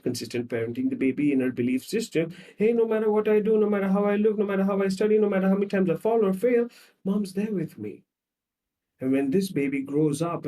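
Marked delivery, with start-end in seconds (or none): 2.6-8.0 put-on a little higher, trusting child voice; lightly voiced confident kid; child's inner voice. Baby telling herself mom is always there, lightly voiced.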